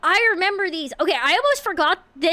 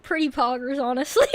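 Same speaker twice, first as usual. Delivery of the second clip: ordinary talk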